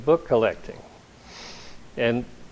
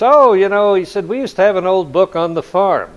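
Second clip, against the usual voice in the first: farm cousin voice; 7 semitones higher, 8 dB louder than usual. Retold or imitated. Actor playing a farm cousin.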